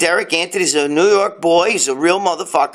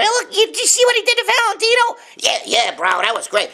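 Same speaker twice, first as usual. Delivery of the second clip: high fast mocking gossip voice. He mocks trolls gossiping online, high and fast. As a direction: mimic the fake-account troll egging them on. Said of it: "going over there and ... telling".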